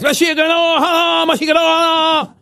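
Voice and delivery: cri aigu et lent; crie-le comme au lit, très aigu; cri de la Japonaise. Acted. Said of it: "Comme elle crie très fort". (la Japonaise crie pendant les ébats)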